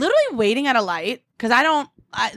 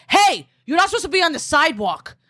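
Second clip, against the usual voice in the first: raised, higher-pitched mock yell; confrontational call-out; 7 semitones higher than usual. Three guys scold a cyclist on the sidewalk, confrontational call-out. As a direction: call out a scolding from across the street.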